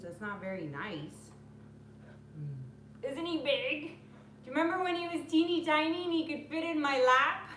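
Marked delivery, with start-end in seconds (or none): none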